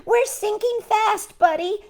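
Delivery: high, slow shout; hammed-up captain's shout. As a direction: shout a warning. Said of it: "shouted Earl".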